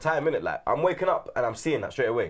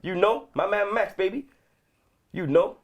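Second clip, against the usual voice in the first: put-on hyped New York American accent, pitched up; 8 semitones higher than usual. A pundit back from New York riffs on Harlem streets in an American accent. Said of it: "that American accent".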